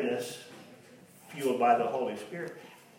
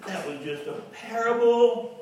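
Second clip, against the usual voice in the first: mock excuse; 9 semitones higher, 5 dB louder than usual. Mocking excuse voice.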